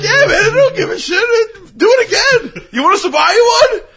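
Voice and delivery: high and loud, laughing through the words. Retold or imitated. Quoting the guy while cracking up, high laughing voice. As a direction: squeal it out between laughs.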